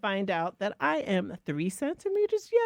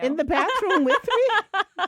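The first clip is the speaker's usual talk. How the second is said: higher and louder, slowed by laughter; laughing; 12 semitones higher, 8 dB louder than usual. Real, not acted. Mother recalling the bathroom pump, laughing hard. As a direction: burst out laughing mid-phrase. Laughing at her own story.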